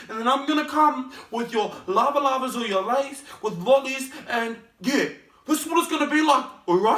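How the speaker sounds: loud, high-pitched hammed-up New Zealand accent; stupid parent accent. Parents telling off their child for quitting school, shouted mock New Zealand accent. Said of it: "That was just a stupid accent".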